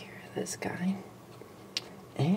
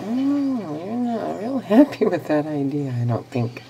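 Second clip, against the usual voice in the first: surprised laugh-talk; 9 dB louder than usual. Real, not acted. Lizard rejects petting.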